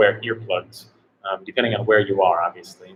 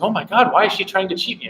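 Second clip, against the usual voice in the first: shocked tourist; 8 semitones higher, faster than usual. Retold or imitated. German wife shocked.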